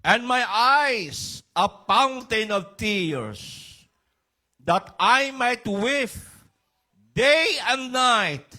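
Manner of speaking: high, loud and slow lament; fervent scripture reading. Acted. Preacher reading Jeremiah's lament to the congregation, high and slow. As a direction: stretch each word like a grieving prophet.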